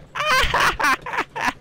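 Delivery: very high, put-on cackle. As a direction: cackle right up at the top of the voice. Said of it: "I cackle".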